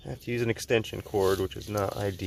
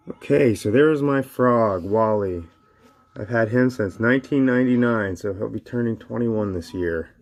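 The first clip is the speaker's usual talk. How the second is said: ordinary talk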